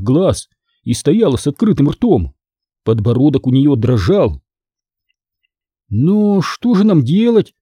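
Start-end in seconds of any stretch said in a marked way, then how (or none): 5.9-7.5 high, slow appeal; a touch of helpless alarm; exclaiming. A narrator's reading. Shocked girl asking her friend for a way out, exclaiming. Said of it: "наконец воскликнула она".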